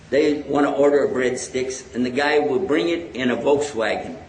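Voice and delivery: ordinary delivery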